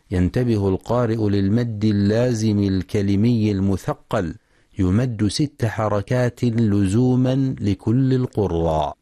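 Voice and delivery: normal talking voice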